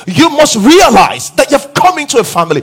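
loud, high, driving sermon delivery; fervent preaching. Acted. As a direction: preach it out to the whole hall, rising. Preacher at full force again.

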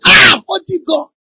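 high, loud, slow exclamation; drawn-out cry of amazement; acted outcry. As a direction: shout like an overcome churchgoer. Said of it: "They say".

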